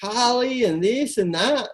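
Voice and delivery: high, drawn-out, breezy yeah that's cool; chatty agreement. Retold or imitated. Chatting with the Uber driver, breezy and high. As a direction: play along casually with the driver. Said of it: "I was like".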